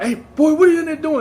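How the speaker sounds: loud, high fatherly yell; retold dad's yell. Dad catching himself yelling at his son, imitated yell. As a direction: holler at a kid in another room, imitated. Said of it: "even, like, yelling".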